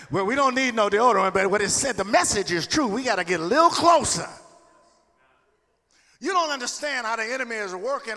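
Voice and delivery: loud fervent sermon delivery; preaching. Acted. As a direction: preach it out to the church. Preaching at full force.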